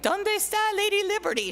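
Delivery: high, eager voice of a mom searching out the window; high squeaky mother voice, calling out; imitating mom. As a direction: play the hopeful immigrant mother looking for Lady Liberty.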